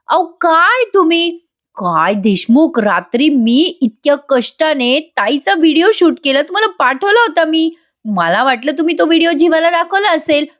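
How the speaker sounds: उंच स्वरात हताश तक्रार; नवऱ्याला वैतागून, उंच आवाजात जाब विचार; वैतागलेली तक्रार. Acted. काव्या देशमुखवर वैतागते. (वैतागून, चढ्या स्वरात तक्रार)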